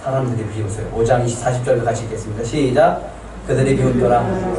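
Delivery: ordinary delivery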